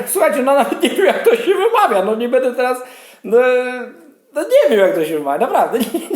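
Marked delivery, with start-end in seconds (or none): 0.1-4.8 laughing through the words, much higher; amused, laughing admission. Real, not acted. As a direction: giggle helplessly over the name. Laughing at a Turkish name.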